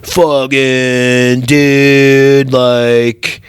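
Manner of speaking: slow, drawn-out dude voice; mock bro. Bro introducing himself to the judge, slow mock bro voice. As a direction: drag the words out like a dim frat bro. Imitates next candidate, a bro.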